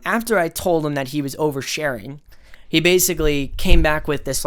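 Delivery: normal talking voice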